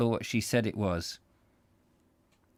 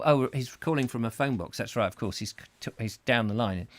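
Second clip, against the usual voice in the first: ordinary delivery, nothing marked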